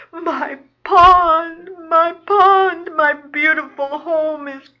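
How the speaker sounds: high, loud cry of despair; wailing. Acted. Frog mourning her lost pond, wailing. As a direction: wail it out with drawn-out grief. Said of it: "she wails".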